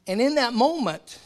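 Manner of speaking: sudden loud lift; preaching build. Real, not acted. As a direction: raise the voice to open the moment.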